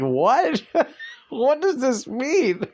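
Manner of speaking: high, slow bewildered question; puzzled passerby. Retold or imitated. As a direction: act baffled reading the note. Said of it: "People walking by like".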